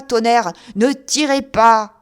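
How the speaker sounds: terrified man begging not to be shot; rising terrified cry. Acted. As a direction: climb into a frightened yell. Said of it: "Sa voix montait, rauque, épouvantée".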